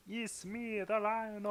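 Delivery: lightly voiced Rhino line, high; a hint of a silly villain voice; Rhino impression. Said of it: "Sounded more like Kermit the Frog".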